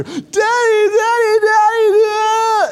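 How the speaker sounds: mock child wailing for his dad; imitating toddler crying out. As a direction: call out like a scared little boy in the night. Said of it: "I hear".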